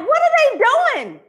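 high, loud, shocked cry; baffled fan. Retold or imitated. Shocked fan shouting at his team in disbelief.

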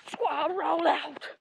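slow, loud rallying yell; drawn-out shout. Real, not acted. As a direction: stretch out the rallying cry. Rallying the animals to run.

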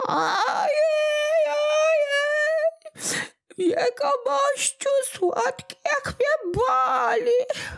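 very high, tearful whine of a tiny creature; a sore little imp wailing for pity; whimpering little goblin. Acted. Little kłobuk crying to old Smętek, very high and tearful. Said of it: "w stronę płaczliwego głosu".

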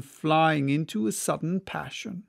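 usual speaking voice

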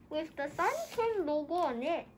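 muffled behind cloth mask; through a face mask. Real, not acted. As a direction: mouth covered by fabric.

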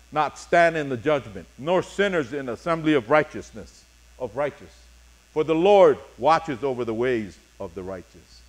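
ordinary delivery

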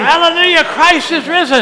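loud, high, slowed proclamation; joyful Easter proclamation. Real, not acted. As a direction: call it out to the whole church. Easter call to the congregation.

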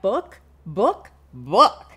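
loud, excited title call; shouted with excitement; with lots of feeling. Shown as a demonstration. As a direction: announce it with big feeling. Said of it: "say it with lots of feeling behind it".

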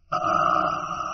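long wordless "ah" of pleasure; airy, inarticulate sound of delight; sigh of pleasure. A narrator's reading. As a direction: exhale a drawn-out, whispered pleased sigh. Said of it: "An inarticulate sound of pleasure".

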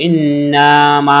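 N held in a nasal hum, MAA drawn out in ইন্না মা; গুন্নাহ. Shown as a demonstration. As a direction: slowly hum the N of ইন্না, stretch মা. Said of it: "সেখানে গুণনা করে পড়বো". (ইন্না-তে গুন্নাহ, মা টেনে পড়া)

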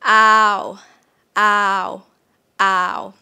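"Ow" loud and drawn out; long vowel "Ow". Shown as a demonstration. Teacher demonstrating the long Thai vowel, drawn-out "Ow". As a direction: stretch "Ow" from ah into a rounded u. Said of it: "The long vowel here is ow".

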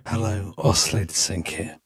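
low, soft granular-stretched voice; a bit spooky, smeared by the effect; spooky processed voice. Shown as a demonstration. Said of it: "Sounding a bit spooky".

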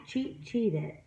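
ordinary delivery

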